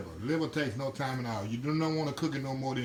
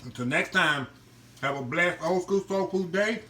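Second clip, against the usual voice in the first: higher, brisk channel outro plug; upbeat sign-off; 3 semitones higher than usual. Acted. Host signing off to viewers, upbeat promo read. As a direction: rattle off the socials like an announcer. Signs off the cooking video.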